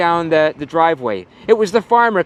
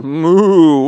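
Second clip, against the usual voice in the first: a cow's moo, a little louder; 5 dB louder than usual. Acted. Cow greets the puppy with a loud moo. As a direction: open with a playful moo.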